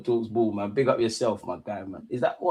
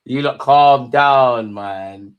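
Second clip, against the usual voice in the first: loud and slow, dragging each word to settle the room; loud slow calm down; 8 dB louder, much slower than usual. Real, not acted. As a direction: stretch it out loudly like a firm plea. Chat getting overexcited, told off.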